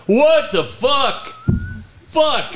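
hammed-up disbelief at a bad trade; retold outburst. Manager cursing over bad trade.

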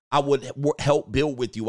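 ordinary talk, nothing marked

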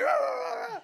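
very high, loud mock barking; barking dog. Imitating the little dog that barked at him, loud high yaps. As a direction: bark like a yappy little dog. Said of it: "he's like".

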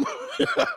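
high-pitched cackling laugh; breaking into real high laughter. Real, not acted. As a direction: crack up at your own joke. Cracks up at own bit.

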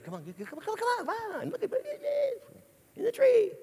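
very high, squeaky urging; mock excited disciples. James and John pointing at the man in the tree, mock excitement. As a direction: call others over in a hammed-up falsetto.

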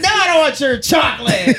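high, loud yell through laughter; laughing shout. Retold or imitated. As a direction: yell it while cracking up. Chance yelling while laughing.

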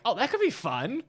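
high, silly Muppet bear voice; Fozzie Bear voice. Retold or imitated. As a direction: talk like a goofy Muppet bear. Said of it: "more of a Fozzie animal".

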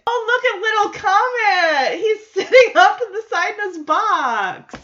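high, loud squeal breaking into laughter; delighted gushing. Real, not acted. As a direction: gush over an adorable puppy. Spots the puppy and gushes.

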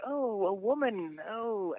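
high, drawn-out mock astonishment; slow, loud put-on gasping at a novelty; mock audience reaction. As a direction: imitate a crowd aghast, high and slow. Imitating audience shocked at woman comic.

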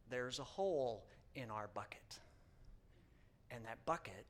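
low, soft whisper of a hard truth; solemn whispered confession; hushed whisper. Real, not acted. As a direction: drop to a whisper for weight. Sermon point, hushed.

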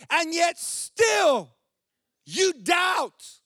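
loud, high, shouted preaching; preaching at full force. Acted. Pastor shouts Jesus' question to the congregation at full force.

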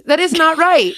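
hammed-up outrage at the screen; retold yell. Yelling at the bar show on TV, mock-indignant shout. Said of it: "I yell at the T V".

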